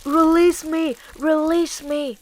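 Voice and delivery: tied-up wolf begging, first cry loudest; imploring. Acted. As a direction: beg to be let go. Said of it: "It implored".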